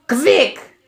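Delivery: high, loud witch shriek; sudden witch shriek. A narrator's reading. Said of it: "shrieked the Grand High Witch".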